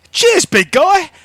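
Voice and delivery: very high, loud thanks; grateful shopkeeper. Acted. Shopkeeper thanking the big stranger who helped him, cheery. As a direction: call out thanks, voice pitched right up.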